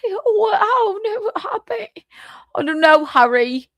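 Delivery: mock British accent. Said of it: "a British accent".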